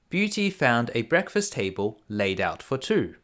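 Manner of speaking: ordinary delivery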